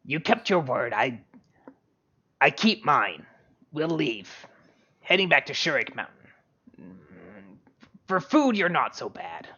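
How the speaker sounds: halting in-character speech with swooping pitch; grudging respect, parting in measured pieces; suspicious character's voice. Acted. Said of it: "but she's like".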